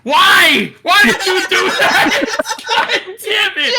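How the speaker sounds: loud, high outraged yell; frustrated shout. Real, not acted. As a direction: yell at the dice in disbelief. Bad roll after jinx.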